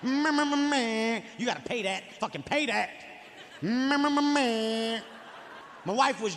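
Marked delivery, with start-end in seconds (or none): 0.0-1.2 DJ noises. Shown as a demonstration. Gloating at a friend who landed on his property. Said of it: "I make D J noises". 3.6-5.0 laughing through "meh meh" scratches; DJ noises laughing. Shown as a demonstration. Gloating over friends with laughing DJ noises. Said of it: "I make D J noises".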